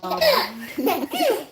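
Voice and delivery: high-pitched burst of laughter; bright, amused giggle breaking out. Real, not acted. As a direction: laugh at your own impression. Laughing at own toddler impression.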